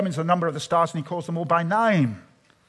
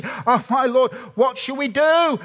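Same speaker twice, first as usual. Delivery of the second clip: frightened servant; 7 semitones higher, 4 dB louder than usual. Acted.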